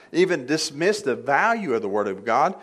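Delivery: ordinary talk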